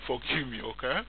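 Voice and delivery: real chuckle, then a soft apology; laughing apology. Real, not acted. Presenter begs viewers' pardon after a slip, laughing. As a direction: chuckle helplessly while asking pardon. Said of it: "I'm laughing".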